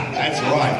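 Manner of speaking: ordinary delivery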